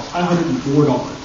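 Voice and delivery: normal talking voice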